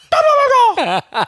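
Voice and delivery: loud, high burst of imitated gobbling; clumsy turkey gobble. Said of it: "Did he try to gobble?".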